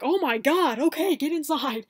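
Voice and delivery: lightly voiced hurried helper; urgent friend. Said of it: "he's like".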